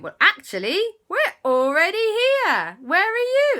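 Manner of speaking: high, loud, gleeful announcement; spring the big surprise; excited reveal. Acted. Uncle reveals they have already arrived, excited.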